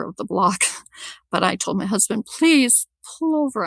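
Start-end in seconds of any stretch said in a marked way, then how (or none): 2.3-3.7 retold plea, pitched high and drawn out; urgent request. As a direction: re-enact urging a driver to stop. Urging husband to stop the car.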